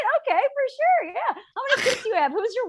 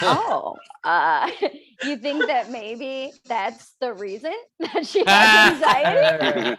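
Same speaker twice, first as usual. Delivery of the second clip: incredulous laughing; 2 semitones higher, 6 dB louder, slower than usual. Retold or imitated. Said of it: "I was like".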